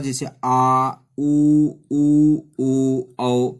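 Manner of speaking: आ, उ, ओ, औ called out loud from the back of the mouth; back vowels shown. Shown as a demonstration. Teacher demonstrating back vowels, loud separate आ, उ, ओ, औ. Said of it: "पश्च स्वर".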